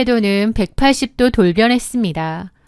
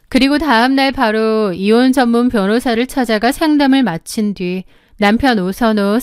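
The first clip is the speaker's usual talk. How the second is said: plain reading voice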